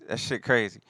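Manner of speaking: ordinary talk, nothing marked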